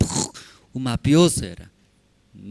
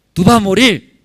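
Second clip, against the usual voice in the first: Goliath's threat; 6 semitones higher, 9 dB louder than usual. Acted.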